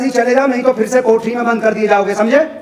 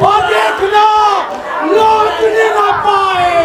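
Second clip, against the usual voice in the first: angry orders; 7 semitones higher, slower than usual. Acted.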